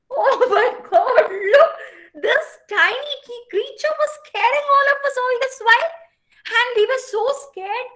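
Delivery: high, loud laughing exclaim; laughing animals. Acted. The animals laugh at the tiny caterpillar, high and loud. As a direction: laugh out the words in disbelief.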